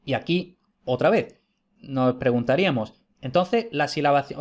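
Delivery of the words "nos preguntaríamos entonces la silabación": usual speaking voice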